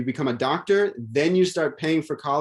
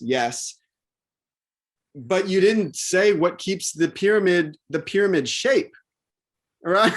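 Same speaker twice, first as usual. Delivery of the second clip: ordinary delivery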